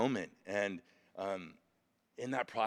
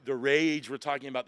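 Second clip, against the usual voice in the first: ordinary delivery, nothing marked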